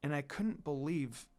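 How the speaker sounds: soft, quiet inner voice, sad; brooding quietly to herself; sad thought. Acted. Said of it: "Kagome thought sadly".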